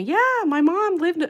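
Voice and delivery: high, loud put-on Southern voice; Tennessee accent. Streamer quoting a Tennessee relative in a mild accent. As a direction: start quoting her relative with a twang. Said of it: "I could just hear her accent".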